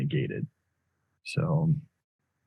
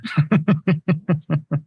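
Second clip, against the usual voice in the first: high, loud burst of laughter at his own accent; real laugh at his own voice; 11 semitones higher, 6 dB louder than usual. Real, not acted.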